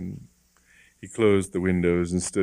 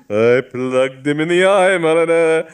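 louder, higher, proud insistence; proud boast; 9 semitones higher, 10 dB louder than usual. Acted. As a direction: hammer the boast home with relish. Bishop proudly repeats his boast.

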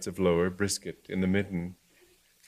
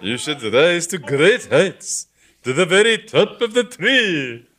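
teasing bishop; 9 semitones higher, 10 dB louder than usual. Acted. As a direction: rib him jovially.

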